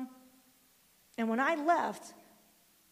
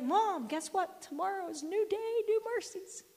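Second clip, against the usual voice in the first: put-on higher, eager child voice; excited kid; 8 semitones higher than usual. Grandson telling mom, mock excited. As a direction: bubble over like a happy boy. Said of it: "he was all excited".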